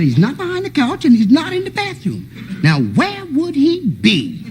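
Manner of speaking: high, slowing mock puzzlement. Uncle's teasing search.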